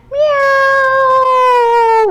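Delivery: long high meow like a spooky cat. Shown as a demonstration. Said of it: "spooky cats".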